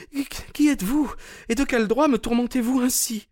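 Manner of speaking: high-pitched indignant maid's voice; sullen, astonished maid. Acted. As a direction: stammer the first word, then protest up high.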